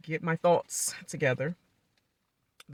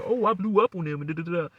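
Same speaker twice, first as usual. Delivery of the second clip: mock bragging. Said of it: "they be saying about their conversations".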